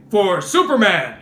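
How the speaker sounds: loud, booming hero declaration; Superman. Acted. Cartoon hero revealing himself after opening his shirt, loud heroic voice. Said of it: "and then he would say".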